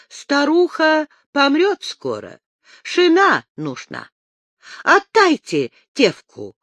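mock native accent, haggling; accented bargaining herder; Samoyed accent. A narrator's reading. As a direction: demand it in a thick Samoyed accent. Native prince haggles in broken accent.

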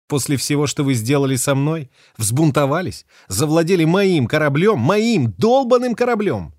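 building to a loud high furious cry; furious outburst. A narrator's reading. Captain raging at the mutineers who took her ship, building loud. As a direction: rage at them over the stolen ship.

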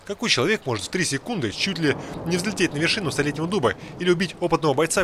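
usual speaking voice

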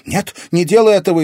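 high, fast, loud begging; panicked girl's shout; frightened plea. A narrator's reading. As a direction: cry out to stop the villain. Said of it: "испугалась Катерина".

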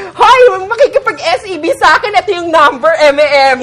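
loud, high announcement to the crowd; announcing. Retold or imitated. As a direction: call it out like an emcee on stage. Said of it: "Kasi announce". (malakas at mataas na anunsyo sa mga tao)

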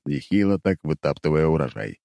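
ordinary delivery

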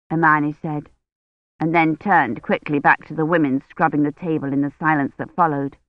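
usual speaking voice

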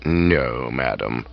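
calm, unruffled butler replies; deferential butler. Acted. Jeeves answering the aunt's questioning.

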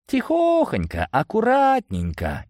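high, singsong coaxing; careful coaxing. A narrator's reading. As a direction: ease someone along in a high, drawn-out voice. Hero coaxing a stuck wizard. (высоко и протяжно, осторожно приговаривая)

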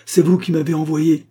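loud, high-pitched accusation; vehement. A narrator's reading. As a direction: throw the blame back forcefully. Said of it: "dit Rosa avec véhémence".

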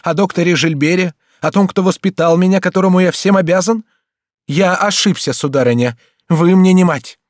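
high, loud, impassioned outburst; indignant cry. A narrator's reading. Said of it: "вскричал он". (с возмущением, почти криком)

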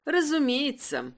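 plain reading voice

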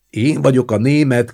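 high, loud boastful call; shouted. A narrator's reading. Guszti rushing past the others again, shouting. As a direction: shout it out in passing. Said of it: "kiabálta Guszti".

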